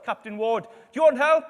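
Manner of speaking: very loud, high mock hail; shouting out. Ship's captain offers help to stranded man, shouting high and loud. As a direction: holler across the water from a ship. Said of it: "Andrew Ward shouts out".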